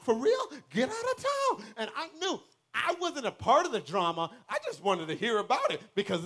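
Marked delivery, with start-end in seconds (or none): 0.0-1.7 mock amazement. Hearing gossip, faking amazement.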